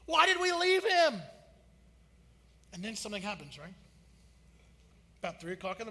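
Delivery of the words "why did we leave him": higher, louder, frantic mock panic; pleading in a slowed-down frantic voice; panicked disciples. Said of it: "they're in that moment going".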